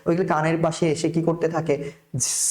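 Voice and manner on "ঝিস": imitated insect buzzing, a sustained zzz; bee buzz. Teacher imitates big bees near the ear, buzzing. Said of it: "এরকম আওয়াজ করতে থাকে একটা".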